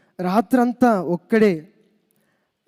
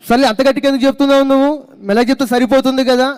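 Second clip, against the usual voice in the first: mock scolding; 6 semitones higher, 4 dB louder, faster than usual. Caller scolds the pastor.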